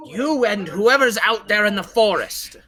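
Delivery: insistent character voice, high and loud; Shadarkai calling out. Acted. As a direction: call out to those hiding in the forest. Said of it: "behind the Shidarkai voice".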